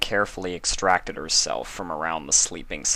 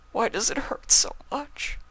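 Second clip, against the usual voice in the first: high, breathless, whispered plea; begging through tears. Acted. Spike begging Luna through tears, heartbroken. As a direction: beg with a broken voice. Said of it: "Spike begged".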